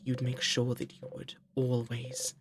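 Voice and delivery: hushed vow. A narrator's reading. Quiet resolve.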